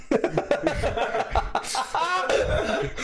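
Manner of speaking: real laughter; laughing. Real, not acted. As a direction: laughs through the words. Laughing at his pacifist line.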